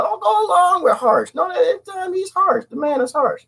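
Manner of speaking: high whiny voice; high whiny mock complaint. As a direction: whine it like a wounded friend.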